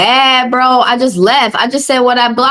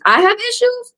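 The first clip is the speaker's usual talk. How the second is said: incredulous; 11 semitones higher, 3 dB quieter than usual. Real, not acted. Repeating the insult to her viewers, high and disbelieving. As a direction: pitch jumps high, voice drops softer in disbelief.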